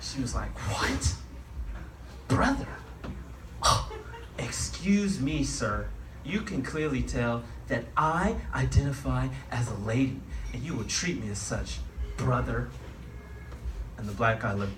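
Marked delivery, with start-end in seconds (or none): 0.7-12.7 slow, drawn-out mock outrage settling into a scolding; mock indignation. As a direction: act offended and correct them firmly. Affronted lady corrects the drunk guy.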